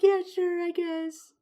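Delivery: high-pitched mock crying voice; imitated tearful reply. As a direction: play the weepy waitress grudgingly agreeing. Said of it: "and she's crying".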